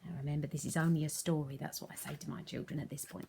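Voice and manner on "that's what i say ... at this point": fast soft whispered aside. Real, not acted. Teacher aside to viewers, fast whisper. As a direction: murmur it quickly.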